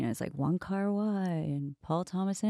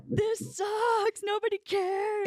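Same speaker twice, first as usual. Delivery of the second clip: mock whiny complaint; slower than usual. Retold angry rant after audition.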